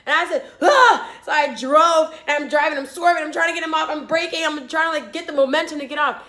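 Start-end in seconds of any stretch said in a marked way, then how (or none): 0.6-1.1 short high, loud yelp; retold scream. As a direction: cry out in alarm, retelling it. Retold own cry while attacked.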